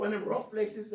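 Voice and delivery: normal talking voice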